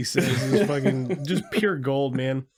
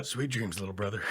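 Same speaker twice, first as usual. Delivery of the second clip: low, soft and tender; soft goodnight; 6 semitones lower, 9 dB quieter, faster than usual. Retold or imitated. To his brother, a soft low goodnight. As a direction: hush it like a goodnight. Movie quote, tender whisper.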